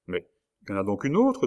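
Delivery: ordinary delivery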